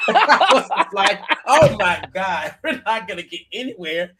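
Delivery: cracking up while retelling; words pushed out through laughter; laughing. Real, not acted. Telling the watchers her reaction, laughing hard.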